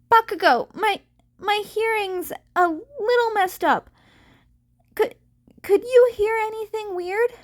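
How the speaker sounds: put-on dazed boy checking with his partner; worried Izuku. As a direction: call out uneasily, unsure of his ears. Izuku worried after being hit.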